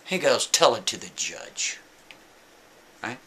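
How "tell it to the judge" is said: low, slow flat cop brush-off; deadpan dismissal from the officer; stern cop. Retold or imitated.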